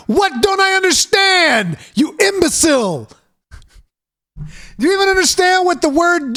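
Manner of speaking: high-pitched exasperated yelling; furious rant. Acted. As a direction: explode at the devs, outraged. Raging back at the developers.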